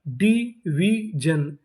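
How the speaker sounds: Division very slow as डी, वी, जन; syllable by syllable. Shown as a demonstration. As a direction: sound out division with gaps between syllables. Teacher sounding out new word.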